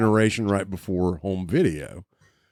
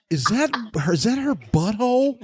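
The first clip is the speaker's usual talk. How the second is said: high, incredulous question; mock-aghast, pitched way up; retold shocked disbelief; 14 semitones higher than usual. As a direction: replay a kid's appalled discovery. Retelling young self's shocked reaction.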